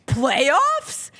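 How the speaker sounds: rising incredulous Playoffs? through a laugh; mock disbelief; Jim Mora impression. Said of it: "Give me Jim Mora".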